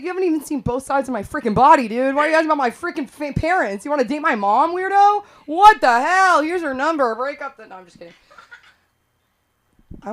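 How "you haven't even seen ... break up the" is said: fast, high, loud mock rant; hammed-up indignant yelling; mock outrage. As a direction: rant at the guy in a higher, louder voice. Mock outburst at dating app guy.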